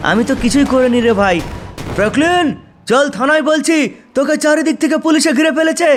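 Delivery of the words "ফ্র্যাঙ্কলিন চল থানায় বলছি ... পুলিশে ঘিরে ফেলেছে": calling out. Acted. Policeman orders Franklin to station.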